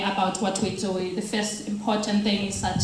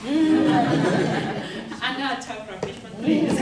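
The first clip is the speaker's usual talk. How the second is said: laughing; 4 semitones higher, much slower than usual. Real, not acted. Jokes after mic cut off.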